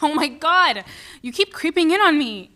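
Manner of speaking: wife startled by husband sneaking in; startled yell. Acted. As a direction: jump and shout at him.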